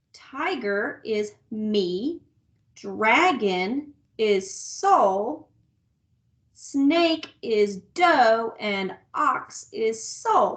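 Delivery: very slow, each word spaced out; slow and deliberate. Shown as a demonstration. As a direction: spell it out slowly for the kids. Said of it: "if we were gonna use solfege".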